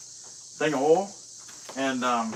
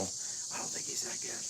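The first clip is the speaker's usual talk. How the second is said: hushed, much softer confidential aside; whispered aside; 14 dB quieter than usual. Real, not acted. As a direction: whisper it so the eagle can't hear. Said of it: "I just didn't wanna hurt his feelings".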